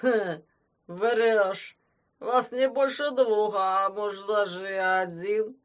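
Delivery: put-on slow, loud drunken doubt; a drunk squinting in suspicion; doubtful drunk. A narrator's reading. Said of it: "с сомнением сказал пьяный".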